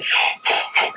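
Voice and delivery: hushed unvoiced shh; shushing. Mother shushes her fidgety son at a wedding, hushed. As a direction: hush a wiggling child in a pew.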